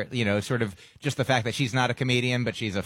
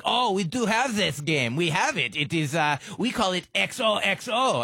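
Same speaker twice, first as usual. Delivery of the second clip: mock French accent; 7 semitones higher than usual. French guys explaining their game, high mock French accent. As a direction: play the French guy proudly naming the game, French accent. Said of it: "a perfect accent".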